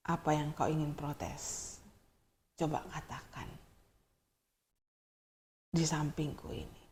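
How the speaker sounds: calm him down, speak low and kind; gentle pastor. Acted. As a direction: pastor gently asks the young man to sit close. Pastor gently invites him closer.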